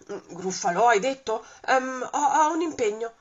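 a monster making a shaky excuse; nervous monster. Acted. Il gruffalò teme il topo. (mostro nervoso, risatina e balbettio)

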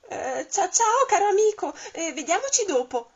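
high, loud, stuttering goodbye; a scared owl fleeing politely; stammering fright. Acted. La civetta vede il gruffalò. (civetta spaventata, balbettante e acuta)

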